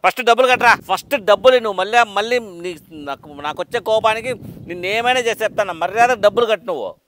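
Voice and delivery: loud, furious demand for the money; angry demand. Acted. Lender furiously demands his money back from the debtor, loud. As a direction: bark at him to pay up first.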